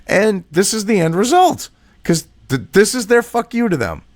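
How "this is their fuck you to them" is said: normal talking voice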